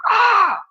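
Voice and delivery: high, loud "ah" of pain; acted pain yelp. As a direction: yelp as if your toe was just stubbed. Acted yelp after stubbing a toe.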